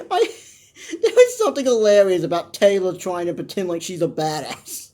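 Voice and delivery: normal talking voice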